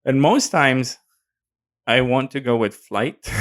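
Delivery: ordinary delivery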